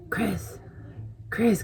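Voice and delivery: soft whispered name, rising high; whispered call. Retold or imitated. Whispering to her sleeping boyfriend on the phone. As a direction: whisper his name to wake him.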